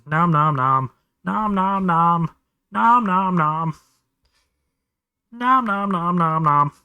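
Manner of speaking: playful chomping chant to a kitten; nom nom chomping. Acted.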